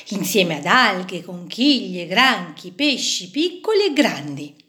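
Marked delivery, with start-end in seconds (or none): none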